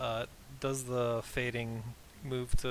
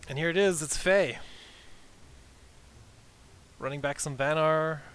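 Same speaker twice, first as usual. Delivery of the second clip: hyped game-opening call; 4 semitones higher, 4 dB louder than usual. Acted. Caster opens the new game.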